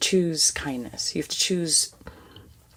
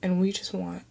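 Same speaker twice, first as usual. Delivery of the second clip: higher, hushed, near tears; emotional; 5 semitones higher than usual. Real, not acted. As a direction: hold back tears while you speak. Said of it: "I'm getting a little bit emotional".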